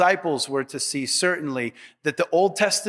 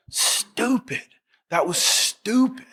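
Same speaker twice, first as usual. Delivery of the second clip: self-scolding Peter; 7 semitones higher, slower than usual. Retold or imitated. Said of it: "Just kicking himself".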